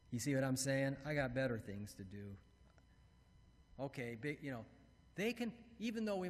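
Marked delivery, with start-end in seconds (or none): 1.0-2.4 dismissive. Acted. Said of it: "our voice says".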